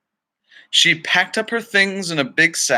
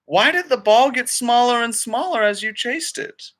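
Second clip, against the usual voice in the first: put-on high, eager children's questions; lightly voiced curious grandkids; bright kid voices asking questions; 10 semitones higher than usual.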